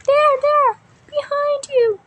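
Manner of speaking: high, loud frightened cry; scared shout. Acted. Engine shouts to Thomas in fright. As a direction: yell the warning in panic. Panics pointing at ghost.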